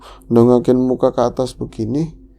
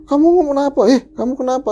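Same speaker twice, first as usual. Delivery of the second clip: high, loud urgent questions; alarmed questioning; 13 semitones higher, 5 dB louder, faster than usual. Retold or imitated. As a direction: act someone demanding what is wrong. Alarmed man shaking her, questioning. (bertanya panik, tinggi dan keras)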